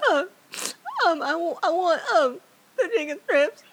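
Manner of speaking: high, loud, weepy voice with a laugh; teary ordering. Retold or imitated. Teen crying at a restaurant, ordering from her family, weepy. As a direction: squeak out the order while still crying. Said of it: "and so I'm just crying".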